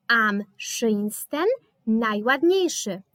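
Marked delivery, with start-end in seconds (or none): 0.1-1.5 am schönsten said slowly and carefully; slow demo. Shown as a demonstration. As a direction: spell out am schönsten syllable by syllable. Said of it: "z przodu am, z tyłu sten". (powoli i wyraźnie, jak dla uczniów)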